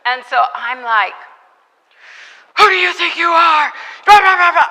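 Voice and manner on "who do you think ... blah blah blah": high, loud, furious scolding; angry telling-off. Retold or imitated. Missionary scolding the drunk men at the gate, loud and furious. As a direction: let them have it. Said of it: "I just let 'em have it".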